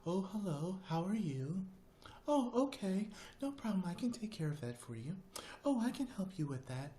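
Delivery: high-pitched, prim and proper lady voice; sweetly helpful, high imitation of a neighbor; proper homemaker. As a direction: imitate an oh-so-proper Betty Crocker type. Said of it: "she literally talked like that".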